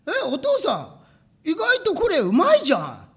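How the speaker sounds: high, loud happy surprise; delighted family exclaiming; surprised delight. Retold or imitated. Family to father on tasting crackers, excited surprise.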